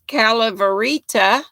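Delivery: ordinary talk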